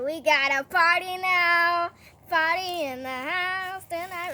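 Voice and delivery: party announcement. Acted. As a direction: play a king declaring a party.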